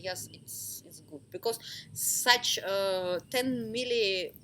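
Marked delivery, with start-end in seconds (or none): 0.1-1.2 much softer, breathy whisper; clients' hushed "it's good"; whispered reply. Retold or imitated. Retold clients quietly answer.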